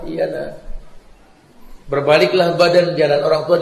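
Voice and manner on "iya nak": put-on soft, frail reply of an elderly mother; old mother's voice. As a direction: answer quietly like an aging mom. Mother blesses departing child. (suara ibu tua yang pelan)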